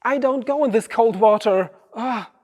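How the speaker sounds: high, loud, complaining voice; high-pitched protest at the cold water; whiny refusal. Retold or imitated. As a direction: balk at the icy water like a scared kid. Acts reluctant self at cold water.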